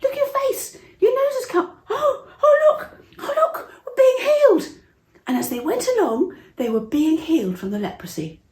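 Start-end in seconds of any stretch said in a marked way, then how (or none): 0.0-4.8 very high, loud, laughing and crying with joy; overjoyed healing. Acted. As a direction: gush with tearful delight at a miracle. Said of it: "his friend said".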